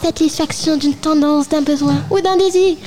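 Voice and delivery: lightly voiced Pleasure; Pleasure character. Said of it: "Je suis le plaisir".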